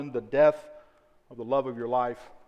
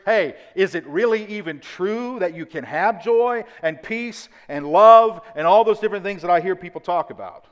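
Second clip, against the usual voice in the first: loud, raised-pitch earnest question; curious questioning; 3 semitones higher, 6 dB louder than usual. Acted. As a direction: voice a doubter's insistent wondering. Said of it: "So you're just curious".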